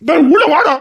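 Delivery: high, loud cry to come in; pleading shout. Acted. Father shouting at son to come inside, high loud plea. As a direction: yell for him to come back inside.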